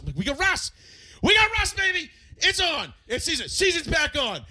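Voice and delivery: very high, loud victory shouting; hyped-up triumphant yelling, acted out; floored and elated. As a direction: shout it like a fan celebrating. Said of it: "I was floored".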